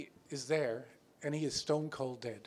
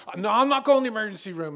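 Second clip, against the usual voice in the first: adamant refusal; 6 semitones higher, 10 dB louder, faster than usual. Retold or imitated. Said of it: "he's just adamant".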